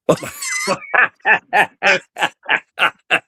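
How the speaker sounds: high bursting laugh; loud laugh. Real, not acted. Laughing hard at dad's movie quote.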